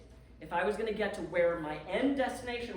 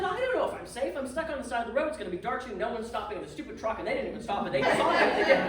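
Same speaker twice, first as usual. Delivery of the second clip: going off again; 3 semitones higher, 5 dB louder, faster than usual. Retold or imitated. Venting to the landlady that the truck saw her. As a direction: climb until it cracks into a laugh. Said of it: "And I go off again".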